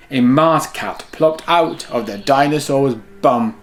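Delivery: lively storybook read-aloud; reading to children. A narrator's reading. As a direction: read it like bedtime story time for kids. Reads picture book to children.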